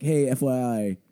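ordinary talk

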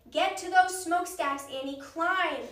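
loud, urgent yell to his sister. A narrator's reading. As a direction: yell it over the noise. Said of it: "he yelled".

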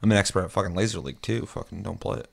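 ordinary talk, nothing marked

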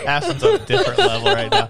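squealing through giggles; can barely get it out for laughing. Real, not acted. Giggle fit.

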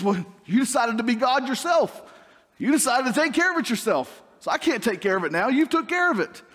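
lightly voiced God rebuking; God's rebuke. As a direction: scold them for playing God.

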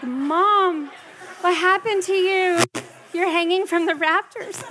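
a hint of startled kids questioning mom; kids' voice. Kids asking their frazzled mom what happened. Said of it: "Everybody's like".

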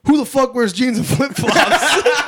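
high, loud, drawn-out mock holler; hollered heckle. [name] butts in to mock the stranger's outfit, loud high holler. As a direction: holler it slowly as a mocking challenge.

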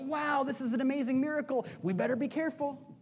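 high-pitched mock amazement turning to worry; awed but cautious onlooker. Preacher mimics people at the miracle, amazed then wary. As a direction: play an onlooker marvelling, pitched well up. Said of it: "people there that went".

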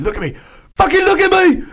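mock command building to a high shout; Gordon Ramsay. Podcaster imitating the chef scolding a cook, building mock rage. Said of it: "He said".

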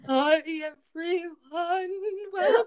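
nervous trembling voice, slow and high; shaky voice. Retold or imitated. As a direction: mimic a nervous first-time host's trembling greeting. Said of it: "I had, like, a shaky voice".

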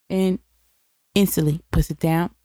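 ordinary delivery

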